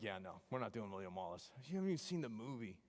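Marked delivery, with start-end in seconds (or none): 0.0-1.5 put-on bored kids. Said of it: "They're like".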